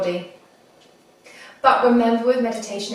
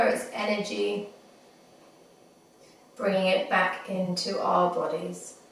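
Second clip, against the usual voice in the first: guided meditation. Acted. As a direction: ease them gently into the breath. Leads a body scan meditation.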